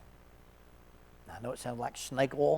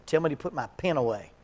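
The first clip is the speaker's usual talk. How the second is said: louder, half-playful command; mock instruction; 9 dB louder than usual. Counselor staging pen demo with client, louder playful command. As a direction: bark the setup to the client.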